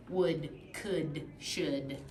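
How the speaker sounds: Would, could, should said slowly, each ood drawn out; teacher models the ood words clearly; model rhyming words. Shown as a demonstration. Teacher shows a student the ood rhyme, each word drawn out. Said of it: "the rest is ood".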